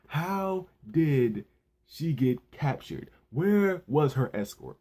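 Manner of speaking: slow, emphatic mock interrogation; pissed-off Sakazuki. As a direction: imitate a pissed-off superior, word by word. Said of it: "he's already pissed 'cause he hates Luffy".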